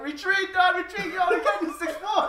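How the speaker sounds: high, loud mock panic yell; scared gang members. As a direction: shout it like a spooked thug, hammed up. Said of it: "they're like".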